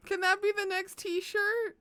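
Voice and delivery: usual speaking voice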